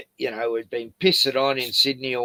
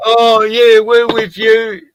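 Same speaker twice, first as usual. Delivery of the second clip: loud, high, slow mock voice; mocking naive protester; 8 semitones higher, 10 dB louder, slower than usual. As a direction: ham up a gushing naive ally.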